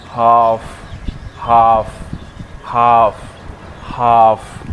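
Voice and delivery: हाफ loud, with a held "aa"; loud, separate model takes of हाफ, long vowel; long aa sound. Shown as a demonstration. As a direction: stretch हाफ into "haaaf". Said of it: "इसको बोलते हैं".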